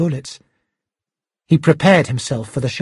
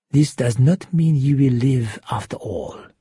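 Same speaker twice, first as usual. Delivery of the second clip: restrained, threatening character voice; menacing shark villain; 2 semitones lower than usual. Acted. Shark warning Hero he will not survive, menacing. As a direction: warn with cold, controlled menace.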